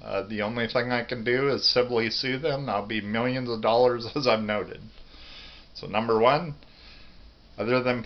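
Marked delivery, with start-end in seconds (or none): none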